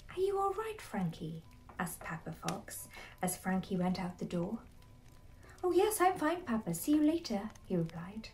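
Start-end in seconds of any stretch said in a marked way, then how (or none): 0.1-1.4 father's question starting high; concerned father. A narrator's reading. Papa Fox checks on his son at the door, mild concern. 5.6-7.5 high, loud cheery reassurance; breezy young fox. A narrator's reading. Frankie calls back to Papa as he leaves, breezy reassurance.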